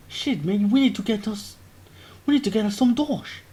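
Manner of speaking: a mock London lad; British lad voice. Waffle Sniff urges the broke squad, British lad voice.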